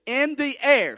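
in the air, called out for the church to echo; emphatic call. Shown as a demonstration. Preacher leading his congregation, loud call. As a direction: punch air, loud, for them to repeat. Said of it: "Say that with me".

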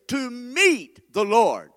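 to meet the Lord, drawn out slow and loud; slow emphatic preaching. Shown as a demonstration. Preacher leading the church, slow and loud. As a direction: stretch every word of to meet the Lord. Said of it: "Say that with me".

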